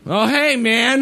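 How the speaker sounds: high silly voice. Pretend. Greeting a cat in funny voice.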